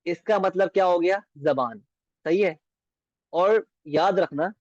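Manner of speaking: usual speaking voice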